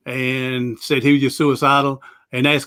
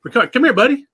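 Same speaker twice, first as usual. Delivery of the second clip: high, louder call to the dog across the room; 9 semitones higher, 4 dB louder, faster than usual. Real, not acted. Owner calling his service dog over, high and loud. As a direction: call him in, bright and carrying.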